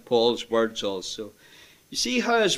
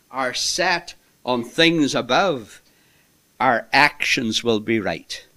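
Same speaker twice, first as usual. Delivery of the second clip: ordinary talk, nothing marked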